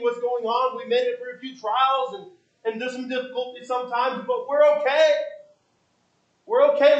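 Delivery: mock a comfortable man who sees no danger; smug contentment. Voicing a complacent unsaved person.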